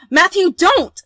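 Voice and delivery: high, very loud warning yell; desperate shout. Acted. Heroine stops Matthew opening the door, desperate shout. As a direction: scream it to stop him.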